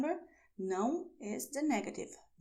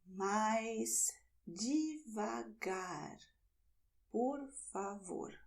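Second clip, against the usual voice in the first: slow model; 3 dB quieter, slower than usual. Shown as a demonstration. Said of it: "teacher models "slower" phrase".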